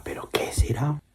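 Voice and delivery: soft wondering whisper; puzzled whisper. Acted. Father wondering to himself, whispered. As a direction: murmur it to yourself, puzzled.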